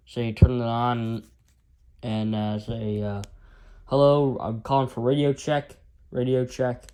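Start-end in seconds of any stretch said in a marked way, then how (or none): none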